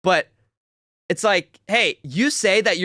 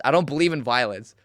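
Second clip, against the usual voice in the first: ordinary delivery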